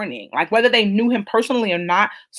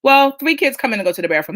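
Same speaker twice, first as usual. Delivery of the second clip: ordinary delivery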